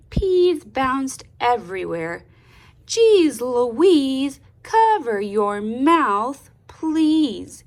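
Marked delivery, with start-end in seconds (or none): 2.9-7.6 louder, stern grandma telling off, slowed. Acted. Grandma scolding Louise at dinner, stern and loud. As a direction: scold her like a cross grandma. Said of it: "Grandma sternly whispered in a not-so-grandma voice".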